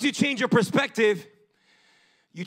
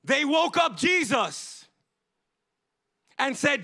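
preaching; 6 semitones higher, 4 dB louder than usual. Acted. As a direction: preach it out with rising force. Preaching the storm story.

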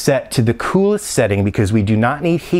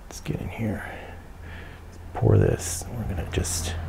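whispering; 11 dB quieter than usual. Real, not acted. Quiet pouring moment.

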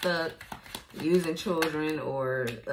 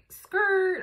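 skrrt; 4 dB louder than usual. Retold or imitated. Brakes-screech reaction.

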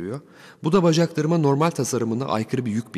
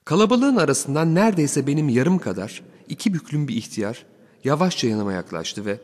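ordinary delivery

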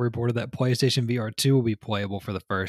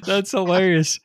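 high, loud words breaking out of laughter; laughing hard; 8 semitones higher, 5 dB louder than usual. Real, not acted. Co-host reacting to a friend's story, laughing hard. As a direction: burst out laughing as you say it. Cracking up at punchline.

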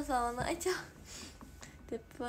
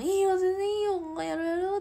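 higher, louder eager yes from a friend; imitating her friend; 8 semitones higher, 10 dB louder than usual. A streamer quoting her collab partner's eager yes. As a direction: play her friend happily agreeing. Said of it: "はやかちゃんも".

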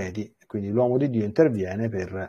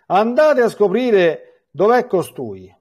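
slow, high, louder royal command; king's order; 9 semitones higher, 5 dB louder, slower than usual. A narrator's reading. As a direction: bark it out as the angry king. King orders his officers. (il re ordina ai suoi ufficiali, comando lento e alto)

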